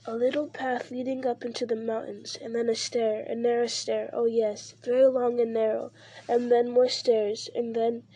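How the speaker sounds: usual speaking voice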